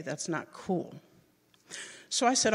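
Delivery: ordinary talk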